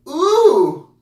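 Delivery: very high, loud mock "ooh"; imitated girls' ooh. Two girls eyeing him, high loud imitated ooh. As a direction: squeal a long high ooh. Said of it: "Canadian girls, are like".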